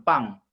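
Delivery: "pang" said with a falling fourth tone. Shown as a demonstration. Teacher shows interpreter the tone, falling "pang". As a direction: drop the pitch sharply through "pang". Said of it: "nada turun".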